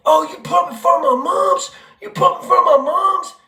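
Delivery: high pitched taunt, voice jumping up; mimicking the scrawny kid's high taunt; high mocking yell. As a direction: ham up a squeaky challenge. Kid yelling at him.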